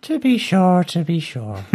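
stage-Irish "to be sure" in a hammed-up Irish accent; teasing Irish accent, jokey; mock Irish accent. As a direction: play the Irish cliché with a wink, Irish accent. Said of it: "my worst Irish accent".

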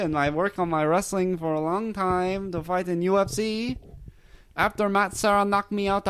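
mock French Canadian accent. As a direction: ham up a French Canadian fighter boasting. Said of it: "very French Canadian accent".

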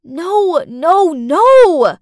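high, loud panicked no; inner scream. Acted. Stepmother screams in her head as the girl lies, loud. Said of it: "I screamed inside my head".

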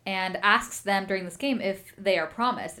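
normal talking voice

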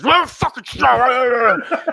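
high, loud mock rant from an irate fan; imitated freak-out. Co-host imitating the mad fan two rows ahead, hammed-up raging. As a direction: roar it drawn-out, hammed-up furious. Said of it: "he started freaking out".

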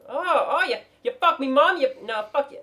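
loud, high, bad Cockney accent; shitty Cockney. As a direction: stumble through a hammed-up Cockney insult. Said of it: "It's a shitty Cockney".

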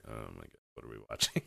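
hushed, awkward murmur of a shocked audience; stunned crowd. Retold or imitated. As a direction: crowd unsure what it is watching, voiced low and quiet. The crowd for the first five minutes was like.

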